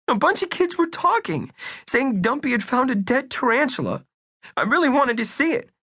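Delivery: put-on high, anxious young boy; earnest little boy. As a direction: play Bunky as a worried young kid. Bunky voice.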